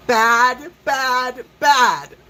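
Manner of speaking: loud, high, hammered repeated bad; emphatic bad. Real, not acted. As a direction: stamp each word down hard. Scolding bad spiritual teaching.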